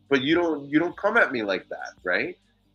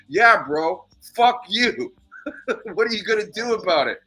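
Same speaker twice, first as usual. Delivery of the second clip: louder, high mock shout of defiance; defiant comeback; 9 semitones higher, 4 dB louder than usual. Imagined reply at the neighbor's door, a loud defiant shout. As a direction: fire it back loud at the door. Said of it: "I wanted to go right to his door and go".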